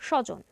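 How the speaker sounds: স্বজন said "shojon" with a weighted first sound; extra initial stress. Shown as a demonstration. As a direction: lean on the opening sh of স্বজন. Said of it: "স এর উপর অতিরিক্ত ঝোঁক পড়বে".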